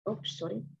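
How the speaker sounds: normal talking voice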